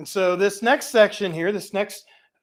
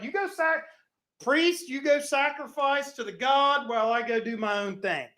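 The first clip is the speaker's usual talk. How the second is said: mocking the Israelites; 7 semitones higher, 4 dB quieter than usual. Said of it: "They were like".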